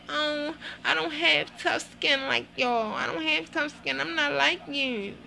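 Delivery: high, slow, whiny put-on voice; mock her self-pity slowly; whiny mockery. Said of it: "some old bullshit talking about".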